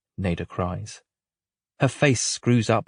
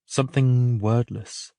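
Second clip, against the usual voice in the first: ordinary delivery, nothing marked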